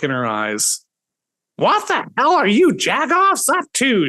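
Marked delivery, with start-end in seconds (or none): 1.6-4.1 crazed mom yelling. Acted. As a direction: shriek at the kids like a mad mother. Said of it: "stormed into the kitchen with a crazed".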